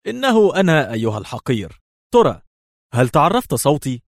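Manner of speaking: high-pitched sarcastic taunt; mocking. A narrator's reading. Escaped woman phoning her enemy, mocking. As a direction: needle him with mock playfulness. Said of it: "وهي تقول ساخرة".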